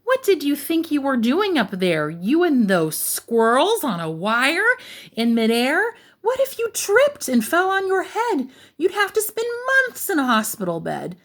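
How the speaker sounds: high-pitched fretful mother's lecture; worried storybook mama telling off her kitten; scolding mama. A narrator's reading. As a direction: scold with rising alarm. Mama scolds son after his stunt.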